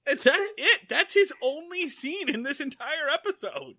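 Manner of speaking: high, incredulous exclamation; amused disbelief. Real, not acted. Host reacting to a child's one tiny scene, incredulous. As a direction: marvel at how little there was.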